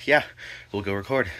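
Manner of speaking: ordinary delivery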